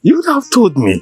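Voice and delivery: loud, high playful reproach; booming mock rebuke of the in-law; mock scolding. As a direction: scold him loudly, but half in jest. King mock-scolding his in-law.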